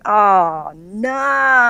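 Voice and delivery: relaxed Australian dismay. Shown as a demonstration. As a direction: demo Australian oh no, mild. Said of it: "the way we say it, we just go".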